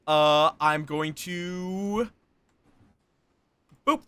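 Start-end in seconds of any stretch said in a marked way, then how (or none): none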